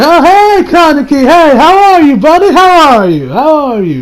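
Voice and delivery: loud, high, singsong hello; over-friendly greeting. Acted. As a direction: gush hello, easing down as it repeats. Voicing an over-friendly greeting to Kaneki.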